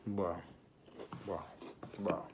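muffled words around food; chewing while talking. Real, not acted. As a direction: mouth full of food. Seen 0: Bit sandwich before; cheek bulges, chewing while speaking behind raised hands.

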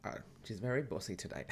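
ordinary talk

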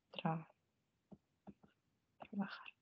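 "трабахар" whispered, Spanish trabajar. Shown as a demonstration. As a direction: breathe "трабахар" with no voice. Teacher models a word. (прошепотіти «трабахар»)

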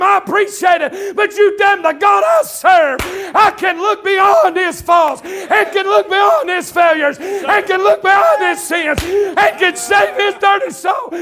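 loud, high-pitched pulpit shouting; full-force preaching. Real, not acted. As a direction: belt it out like a revival preacher, hammering each repeated phrase.

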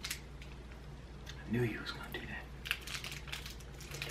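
slow whispered aside; whispering. Real, not acted. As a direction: whisper it slowly, like a secret. Teasing aside to co-host.

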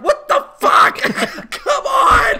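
hammed-up fury at the universe; mock outraged scream. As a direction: scream it in disbelief. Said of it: "She's like".

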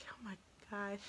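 whispered, stunned aside; barely-there voiceless whisper; hushed shock. Retold or imitated. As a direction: whisper it in retold disbelief. Awed at seeing the birth.

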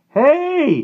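loud, very high cry of alarm; startled yell. A narrator's reading. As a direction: yell out at a blast of heat. Hit by a blast of heat.

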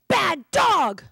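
loud, high scolding shout; furious owner yelling at his dog; angry yell. Acted. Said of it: "Josh yells".

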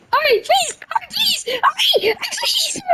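high, thin, urgent begging; panicked pleading. Pretend. Player begs teammate to hurry, high panicked pleading. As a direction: beg desperately, climbing into a squeal.

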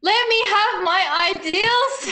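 high, loud, drawn-out protest; mock-anguished plea. Defending royal fantasy from a co-host, mock-anguished. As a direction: wail it slowly like a hammed-up plea.

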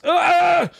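high drawn-out scared cry; frightened shout. Real, not acted. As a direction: shriek as something appears. Scared by girl appearing.

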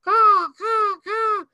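high loud nasal caw; nasal crow caw. Shown as a demonstration. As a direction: caw through the nose like a fish crow. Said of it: "Fish crow sounds like a nasally crow".

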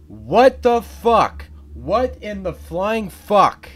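loud, high and slow, every word dragged out; furious shout. Real, not acted. Gamer raging at the game, slow loud outraged shouts. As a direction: scream it in outraged disbelief.

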